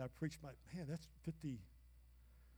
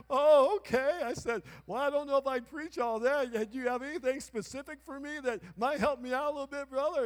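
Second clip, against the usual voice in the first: put-on louder, higher, earnest young man asking for help; a hint of an eager young preacher; 8 semitones higher, 10 dB louder than usual. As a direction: play his younger eager self.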